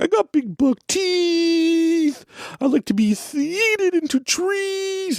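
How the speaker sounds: very high, slow put-on toy voice; Steve the hippo voice. Dad imitating daughter voicing her hippo, very high and slow. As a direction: squeak it out like a stuffed hippo. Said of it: "she'd do the voice".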